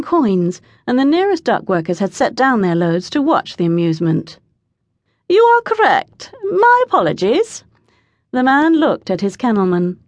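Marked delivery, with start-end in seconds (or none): 5.3-7.6 calling down. A narrator's reading. Man calls down an apology.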